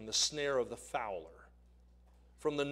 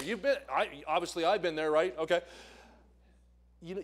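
ordinary delivery

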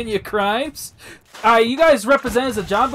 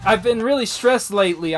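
normal talking voice

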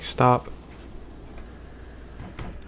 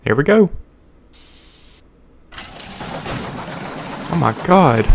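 thrilled; 6 semitones higher than usual. Real, not acted. Drop ride starts.

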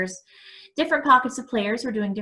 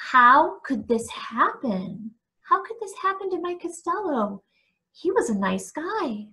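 distressed disbelief; 7 semitones higher than usual. A narrator's reading. Narrator asking himself about Mike's death, distressed. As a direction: ask it in pained bewilderment. I felt sick and confused.

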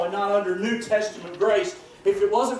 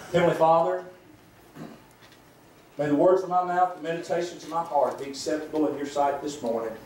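opening prayer; 4 semitones lower than usual. Acted. Pastor praying before the sermon, reverent and measured. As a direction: address God reverently, leaving pauses.

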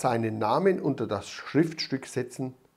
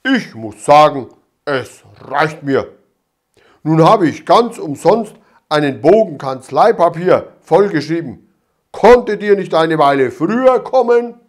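louder, officious policeman grumbling; pompous official scolding; stern constable; 2 semitones higher, 10 dB louder than usual. A narrator's reading. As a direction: constable Dimpfelmoser complaining, stern and loud. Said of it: "machte ein strenges Amtsgesicht".

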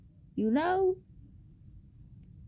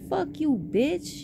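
higher, slow mock snap back; imitating a heated curse-out; retold comeback; 8 semitones higher, slower than usual. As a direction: fire it back at her, drawn out. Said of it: "And I was like".

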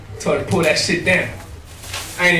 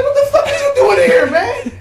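very high, loud, laughing shout; shocked yell; 7 semitones higher, 4 dB louder than usual. Retold or imitated. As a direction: yell in disbelief at your friend. Shocked at finding his homie.

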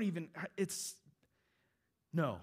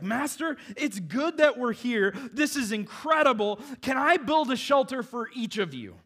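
high, loud, breathless awe; overwhelmed Peter; 10 semitones higher, 10 dB louder than usual. Retold or imitated. Peter gushing to Jesus on the mountain, loud and amazed. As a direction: gush like a dazzled disciple. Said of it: "He, he's just like".

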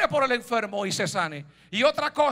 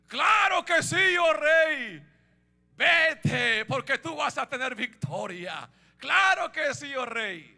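sarcastic, cynical; 3 semitones higher, slower than usual. Acted. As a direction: feign hearty approval of the king. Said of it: "sarcásticamente, cínicamente". (sarcasmo cínico y exagerado)

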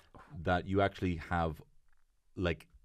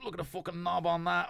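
high mock drunk driver; 11 semitones higher than usual. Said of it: "being like".

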